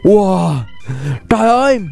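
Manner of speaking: normal talking voice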